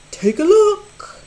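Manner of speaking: high, loud showman call; the cat in a bright showman voice; lively Cat in the Hat. A narrator's reading. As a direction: present the trick like a grinning showman. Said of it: "said the cat".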